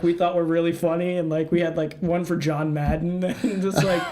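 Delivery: normal talking voice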